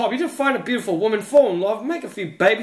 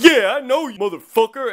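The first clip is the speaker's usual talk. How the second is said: higher, louder guard imitation; mock-hostile guard; 5 semitones higher, 4 dB louder than usual. Guard claiming to recognize the customer, mock hostility. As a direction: accuse him as the riled guard. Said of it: "He's like".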